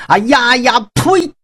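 rising battle cry into a high spit of contempt; scornful outburst. Acted. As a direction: spit out the 呸 in disgust. Huang Feihu scorns the challenge. (高声怒斥一声呸)

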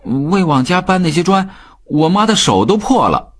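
put-on high, heated child's voice; boy's high-pitched outburst. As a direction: speak up hotly for your mother. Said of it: "聪聪这个时候大声说".

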